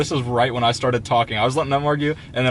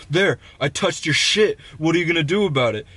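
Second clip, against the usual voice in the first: higher-pitched mock-angry challenge; retold defiant taunt; 5 semitones higher than usual. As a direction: goad them as the angry man.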